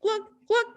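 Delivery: put-on high, bubbly glug glug for the kids; glug sounds. Shown as a demonstration. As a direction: mimic bubbles underwater. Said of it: "Can you go glug?".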